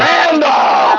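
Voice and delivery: high, hollered name; imitated calling out. Patient yells for her ex-husband, imitated holler.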